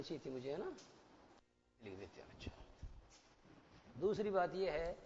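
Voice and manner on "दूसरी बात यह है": usual speaking voice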